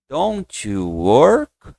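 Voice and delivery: very slow, drawn-out "Don't you work?" with a rising end; slow linked demo. Shown as a demonstration. Teacher models the full question for students, very slow. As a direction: stretch it slowly and lift the last word. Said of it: "Você vai ligar".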